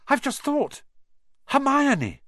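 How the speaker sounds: high, urgent realisation; sudden alarm. Acted. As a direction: blurt it out as it dawns on you. Harry suddenly remembers Hermione.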